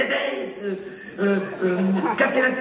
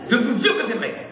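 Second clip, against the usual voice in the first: ordinary delivery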